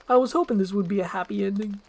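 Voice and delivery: lightly voiced chatty donkey. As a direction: gush it out. Donkey sniffling.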